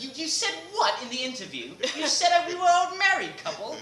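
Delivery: high, loud mock-indignant Jim voice with laughter. Co-star Jim scolding him over the interview, high laughing mock outrage. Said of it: "He's gonna be like".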